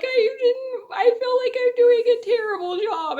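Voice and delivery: high, tearful retelling of an upset; imitated high, whimpering near-cry; nearly crying. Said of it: "I'm, like, nearly crying".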